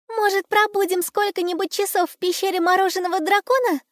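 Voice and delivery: put-on very high girl's voice; little girl voice. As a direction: suggest it like an eager little girl. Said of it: "предложила девочка".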